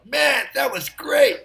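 high, slow, delighted praise; excited praise. Retold or imitated. Engineers in the control room praising the take, excited. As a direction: gush over the take.

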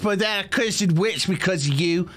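high-pitched indignant Australian mother; Australian accent. Acted. Kobold mother blaming Beetle for her curse, Australian accent. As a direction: blame the child in a put-on Australian accent. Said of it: "my Australian mother?".

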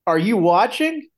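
high, loud excited question; retold excitement. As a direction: imitate a friend calling all worked up. Retelling an excited phone call.

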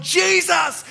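high-pitched shouted call; crying out. Acted. Beggar overcoming noise to reach Jesus, crying out. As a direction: cry out over the noise. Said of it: "he cries out".

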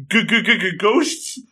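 high, wobbling mock-terrified stutter; cartoon scared stammer. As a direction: stutter it like a cowardly cartoon character. Scooby-Doo style frightened stammer.

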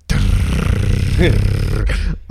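long, loud rolled-tongue trill; vocal drumroll for suspense; imitated drumroll. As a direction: trill it out like a snare roll. Imitates the drumroll before the trick.

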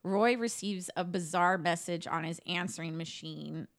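ordinary talk, nothing marked

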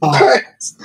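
high, frantic shriek; panicked scream. Retold or imitated. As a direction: shriek at the top of your voice. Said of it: "this lady starts screaming".

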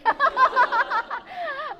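loud, high laugh that falls; a real amused laugh. Real, not acted. Doctor laughing at her own threat, loud laugh.

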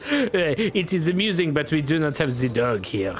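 put-on lightly French-accented gambler, polite and amused; a suave foreign card sharp offering a game; Bretonnian accent. Gambler invites the players to deal, light Bretonnian accent.

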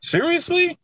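very high, disbelieving question; incredulous. Real, not acted. Host checking friends really never had them, incredulous. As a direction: pitch leaps up in real disbelief.

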